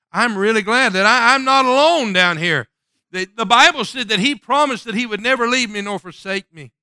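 rising pulpit fervor; preaching with rising fervor. Acted. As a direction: lift the congregation with it.

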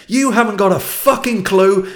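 high, loud furious rant; angry Bolton engineer yelling. Retold or imitated. Old engineer berating his young manager, high loud angry shout. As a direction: lash out at the young upstart. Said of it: "he just went on at me".

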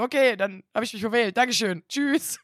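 loud, chipper hang-up; caller voice. Retold or imitated. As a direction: play the cheerful caller. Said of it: "Und er so".

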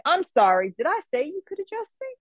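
high mocking indignant question; sarcastic retort. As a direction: act out telling off a stranger, incredulous. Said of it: "And I'm like".

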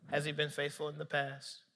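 soft, low, calm question; gentle questioning. Retold or imitated. Holy Spirit asking Nick about his father, gentle. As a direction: ask quietly and patiently.

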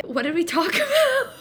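breaking into high laughter mid-question; real helpless laugh on the question; laughing through words. Real, not acted. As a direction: crack up while asking, pitch shooting up. Loses her thread and cracks up.

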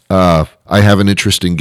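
normal talking voice

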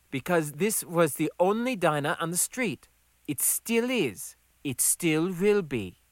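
insist, drawing out the last words; Gonda's voice. Acted.

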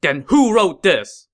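loud, high furious challenge; angry shout. Acted. Sima Shi confronting Cao Fang with the decree, angry shout. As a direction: slam the question down with the decree.

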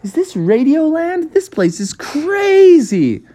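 awestruck, high-pitched wonder; high, drawn-out amazement; amazed Froggy. Acted. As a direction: gasp it out high, stretching the last words. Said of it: "Froggy was like".

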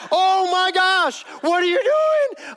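slow, high, loud wail of disbelief; exasperated disbelief. Acted. Companion reacting to a monologue among enemies, exasperated disbelief. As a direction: stretch each word in an appalled high yell.

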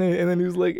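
normal talking voice

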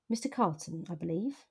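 understated chilly hauteur in narration; cool, mildly haughty greeting. A narrator's reading. Miss Witchwood greeting an unwelcome visitor, mild chilly hauteur. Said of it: "saying with chilly hauteur".

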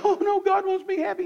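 high, mocking voice of a shocked churchgoer. Preacher mocking a churchgoer, in a high squeaky voice. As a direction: mimic a sweet believer protesting, pitched high. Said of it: "people just can't imagine that".

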